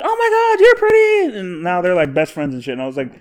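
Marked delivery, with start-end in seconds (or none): 0.0-1.4 very high, louder mock girly squeal; squeaky put-on compliment, building louder; gushing girls. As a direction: imitate women gushing back a compliment. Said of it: "And we're like".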